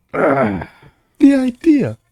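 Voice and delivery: high, slow, sighing mock old man; drawn-out mock sigh; mock Biden sigh. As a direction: sigh it out like a weary old politician. Mocking Biden's catchphrase.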